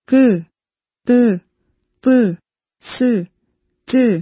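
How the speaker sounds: กึ ตึ ปึ ซื จือ with firm, tensed onsets; slightly forced consonants. Shown as a demonstration. Teacher showing how to pronounce กึ ตึ ปึ ซื จือ, slightly forced. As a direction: put a little force into กึ ตึ ปึ ซื จือ. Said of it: "ต้องออกแรงนิดหน่อย".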